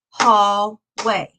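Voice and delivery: "hallway" in two beats with a gap, hall, way; split into syllables. Shown as a demonstration. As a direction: break "hallway" apart as if clapping hall, then way. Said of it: "We had two claps".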